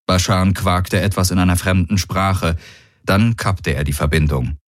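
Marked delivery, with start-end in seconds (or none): none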